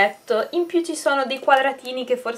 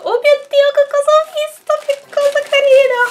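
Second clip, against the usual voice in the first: shrill squeaky excited squeal; high shrill voice, near a squeal; 14 semitones higher, 6 dB louder than usual. Real, not acted. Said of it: "che voce stridula che ho".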